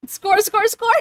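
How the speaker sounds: loud, high victory chant; triumphant high shout; excited cheer. Real, not acted. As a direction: cheer it like you just won. Celebrating a redeem result.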